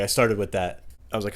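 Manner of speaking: ordinary talk, nothing marked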